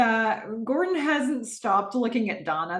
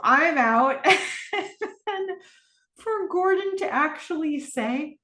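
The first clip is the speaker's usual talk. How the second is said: amused retelling breaking into laughter; laughing; 8 semitones higher than usual. Real, not acted. Host delighted by a character's exit, laughing. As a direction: let a laugh spill out after the quote.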